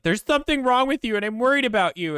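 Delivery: imitating her, high and insistent; worried sister. Sister pestering her out of worry, high-pitched fretting.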